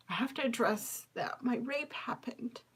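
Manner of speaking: barely voiced whisper, words catching; whispered confession. Real, not acted. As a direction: whisper it as if it's hard to say.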